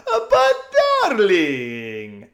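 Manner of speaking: high, loud, slowly drawn out with a laugh; loud gushing drawl. A narrator's reading. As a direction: gush like a spoiled society lady. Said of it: "in the same voice".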